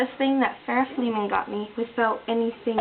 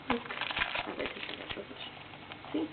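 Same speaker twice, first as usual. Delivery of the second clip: whispering; 13 dB quieter than usual. Real, not acted. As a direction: whisper it close to the mic. Quiet aside to the camera.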